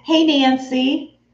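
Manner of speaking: usual speaking voice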